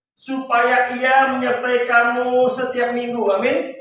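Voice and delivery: full-force preaching. Real, not acted. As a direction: proclaim the verse to the congregation. Preaches and reads verse forcefully. (berkhotbah lantang dengan nada tinggi)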